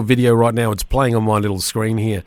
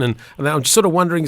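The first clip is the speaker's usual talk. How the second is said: ordinary talk, nothing marked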